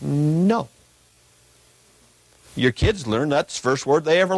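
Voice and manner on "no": "No" with a drawn-out N, tongue on the roof of the mouth; demonstrated enunciation. Shown as a demonstration. Preacher showing the congregation how to say no, deliberately enunciated "No". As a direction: hold the N of "No" before opening into the vowel. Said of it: "put the tongue at the roof".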